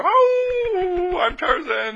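preacher mocking Tarzan's cry, very high and loud; Tarzan call. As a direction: ham up a big Tarzan introduction. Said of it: "they would give out their".

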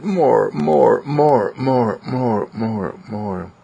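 high, eager pet voice; begging dog. Acted. Dog being hugged begs its owner, high and pleading. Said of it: "he just stands still or just lays still and says".

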